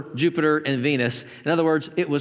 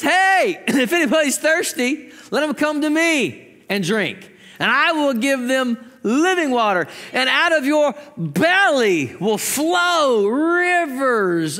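high preacher's proclamation, stretching key words; crying out loudly; 6 semitones higher, slower than usual. Retold or imitated. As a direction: proclaim it to the crowd, building to long drawn-out words. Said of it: "He cries out with a loud voice".

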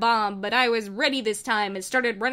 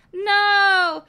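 high, loud wail of grief; anguished cry; 10 semitones higher, 8 dB louder, much slower than usual. Acted. Wife cries out for her fallen husband, wailing. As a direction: scream his name in despair. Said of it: "his wife Alex whatever cried".

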